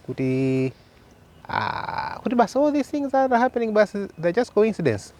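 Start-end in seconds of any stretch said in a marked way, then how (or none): 2.2-5.1 much higher-pitched quoted objection; voicing doubters. Speaker quoting people who dismiss the evidence, high-pitched imitation. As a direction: put the doubters' claim in a raised, high voice.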